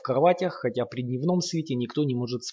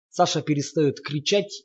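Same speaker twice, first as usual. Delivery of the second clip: ordinary delivery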